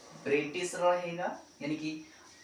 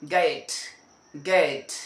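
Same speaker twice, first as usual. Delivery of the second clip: "गेट" louder and firm; corrected get; 2 semitones lower, 5 dB louder, much slower than usual. Shown as a demonstration. Teacher drilling the corrected word, louder. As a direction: punch "गेट" out. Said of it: "गेट नहीं होता".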